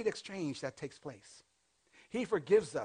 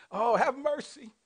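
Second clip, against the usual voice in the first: mock pleading; 9 semitones higher, 3 dB louder than usual. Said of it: "And then all of a sudden you go".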